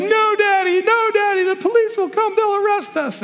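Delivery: put-on very high pleading child voice; little kid. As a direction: squeak it out like a scared little boy. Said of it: "And the kid's like".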